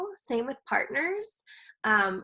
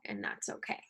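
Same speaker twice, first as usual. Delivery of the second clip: whispered under the breath; hushed self-reassurance; soft whisper; 10 dB quieter than usual. Shown as a demonstration. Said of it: "I always tack on".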